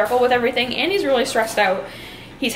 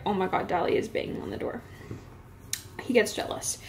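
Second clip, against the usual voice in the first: whispering; 3 semitones lower, 10 dB quieter than usual. Real, not acted. Aside while dog bangs door.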